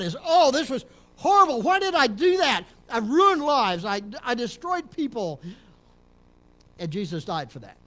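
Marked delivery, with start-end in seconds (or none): 0.2-5.4 anguished regret. Pretend. Imagined sinner regretting past.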